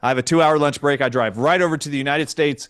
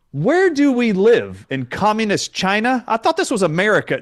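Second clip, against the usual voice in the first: raised-voice rhetorical challenge; retold indignant outburst; 6 semitones higher, 3 dB louder than usual. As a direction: protest in incredulous outrage. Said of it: "This is exactly what I said".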